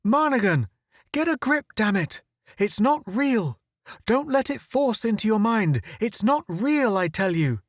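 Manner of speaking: high, urgent shout to snap him out of it. A narrator's reading. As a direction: yell him back to his senses, pitched high.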